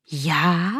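puzzled, startled little "Já?"; surprised. A narrator's reading. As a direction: let the mirror sound surprised. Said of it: "divilo se zrcadlo".

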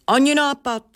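high, sharp dismissal; sneering refusal. A narrator's reading. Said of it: "ozvala se pětiprstka a ušklíbla se".

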